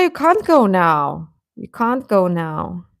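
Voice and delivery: usual speaking voice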